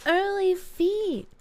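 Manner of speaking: high, slow goofy call; silly drawn-out voice. Pretend. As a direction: stretch it out like a silly cartoon.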